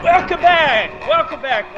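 loud. Real, not acted. Host shouting show opening.

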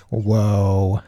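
ordinary talk, nothing marked

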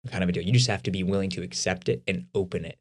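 ordinary talk, nothing marked

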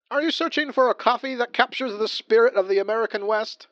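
booming, high cowboy ad read; ad announcer voice. Pretend. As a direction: sell it like a corny old radio ad. Mock coffee commercial read.

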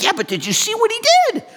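very high, fast, squealing excitement; hyped up. Acted. Hyped-up believer answering an onlooker, excited squeal. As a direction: gush it at top pitch, rushing. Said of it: "You're all hyped up".

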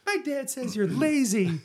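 put-on high, slow child's voice; kid voice. Host plays the kid answering the pilot, high and slow. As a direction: play the snotty kid.

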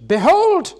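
sudden high, loud cry of wonder; astonished exclamation. Acted. Angel to God's people, a high, loud astonished cry. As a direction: burst out in amazement like an awestruck angel. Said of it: "utters an ejaculation".

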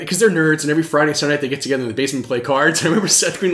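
ordinary talk, nothing marked

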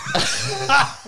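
high-pitched burst of laughter. Real, not acted. Reacting to anal beads story.

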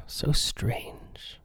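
hushed mock-spooky whisper. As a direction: whisper it like a secret. Mock-spooky comment on features.

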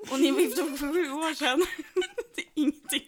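can barely get the words out for laughing. Real, not acted. Co-host cracks up at the story. (skrattande, kan knappt prata)

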